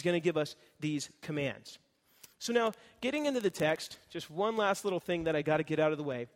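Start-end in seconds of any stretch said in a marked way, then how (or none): none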